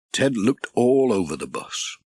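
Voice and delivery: ordinary delivery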